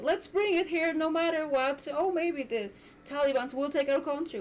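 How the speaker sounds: mock them as careless and naive; mocking quote. Mimicking the careless locals who ignored the threat, sarcastic.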